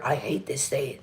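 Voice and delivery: low, soft mock grumble; mimicked grumble. Repeating the housemate's negative remark, mock-grumbling. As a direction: echo the complaint, dropped low and quiet. Echoes the other person's grumbled complaint.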